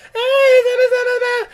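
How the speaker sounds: very high, loud gibberish; mock frantic call. Podcaster acts out Tanya calling in on the radio, mock frantic. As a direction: gabble it in an exaggerated falsetto panic.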